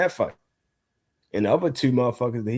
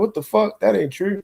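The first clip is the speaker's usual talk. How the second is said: much higher, outraged mock listener; frustrated listener; 9 semitones higher than usual. Guest imitating frustrated viewers of the fighter, in a high outraged mock voice. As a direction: squeak out the listener's disbelief.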